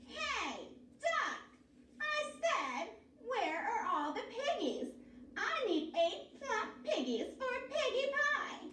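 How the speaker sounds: witch voice. A narrator's reading. Witch demanding piggies from a duck, high and shrill-voiced.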